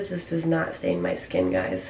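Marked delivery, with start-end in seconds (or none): none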